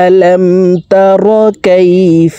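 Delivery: high, slow tajweed chant; sustained melodic recitation, long final vowel; Quran recitation. Shown as a demonstration. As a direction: recite the full verse for learners to follow. সম্পূর্ণ আয়াত বলে দিচ্ছি.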